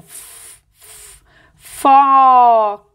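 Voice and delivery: sustained voiceless "F", then a long, loud "fo"; som do F. Shown as a demonstration. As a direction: isolate "F" as pure airflow, then stretch "foca". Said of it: "Olha o som". ("F" soprado e isolado, depois "foca" bem alongado)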